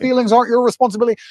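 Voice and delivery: high, insistent mock reassurance; indignant cheerleading. Everyone telling a wife she owes nothing, indignant. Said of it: "If you had a woman who said".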